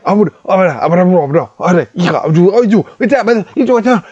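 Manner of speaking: high frantic goblin jabber, loud; loud panicked goblin gibberish; really loud goblin shouting. Acted. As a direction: yell the gibberish across to the other goblin. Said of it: "like, really loud".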